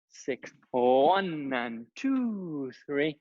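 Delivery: slow counting to the music; counting the beat. Shown as a demonstration. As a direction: stretch each count out in rhythm. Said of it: "we'll do that".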